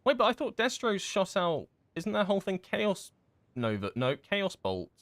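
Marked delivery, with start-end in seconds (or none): none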